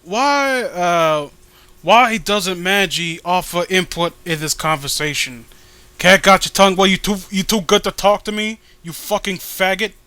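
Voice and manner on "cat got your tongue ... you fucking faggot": loud, hammed-up rant at a silent friend; mock angry rant. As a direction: bark it like you're picking a fight. Berating a silent co-host.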